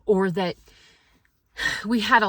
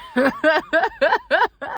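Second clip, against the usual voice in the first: loud laugh; 9 semitones higher, 6 dB louder than usual. Real, not acted. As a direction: burst of real laughter. Laughing at own poor preparation.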